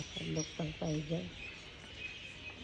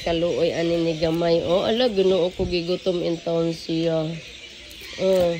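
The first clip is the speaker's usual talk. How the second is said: usual speaking voice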